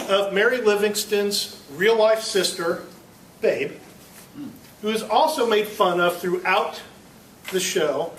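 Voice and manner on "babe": normal talking voice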